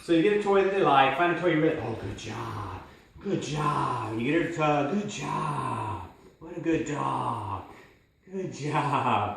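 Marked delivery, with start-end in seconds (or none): 1.8-4.1 soft, slow praise for the dog. Shown as a demonstration. 5.0-9.4 slow, soft coaxing praise. Shown as a demonstration. Praising dog at tug.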